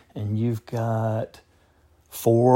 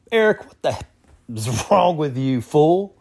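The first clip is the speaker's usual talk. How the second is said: higher-pitched mock exasperation; retold scolding; 4 semitones higher, 4 dB louder than usual. Friend in Jamaica ribbing the caller about Kwanzaa, mock scolding. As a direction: imitate a friend's incredulous telling-off.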